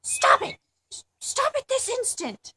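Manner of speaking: high, loud outraged shriek; shrill-pitched screamed command to stop; screaming grandmother. Acted. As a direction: scream it as a scandalized grandmother's order.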